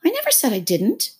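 lightly voiced protesting child; protesting Alice. A narrator's reading. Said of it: "interrupted Alice".

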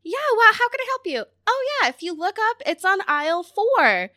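high bright helpful clerk voice; sing-song retail politeness; customer service voice. Retold or imitated. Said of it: "my customer service face".

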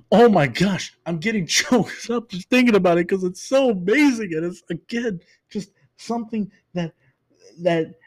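really choked up, high voice with a little laugh; moved almost to tears, pitch climbing, then faltering; high, overcome, struggling to finish the thought. Real, not acted.

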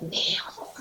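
menacing whispered Kneel; whispered command. Retold or imitated. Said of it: "I'm like".

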